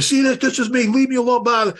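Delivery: imitating his own mad self at roll call; a hint of hammed-up irritation; retold angry brush-off. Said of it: "I'm all mad and pissed off".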